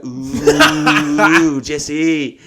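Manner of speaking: high, loud sing-out to tease a pal; playful taunt. Retold or imitated. Waving a revolver at Jesse in the green room, teasing high call.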